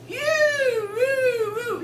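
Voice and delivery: high, loud, drawn-out "woo-hoo"; hammed-up squeal of delight; delighted whoop. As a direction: whoop long and high like an overjoyed wife.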